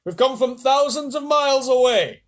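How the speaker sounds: high, loud, slow creature call; grinning shout. Acted. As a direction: shout it back with glee. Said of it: "the centipede shouted back".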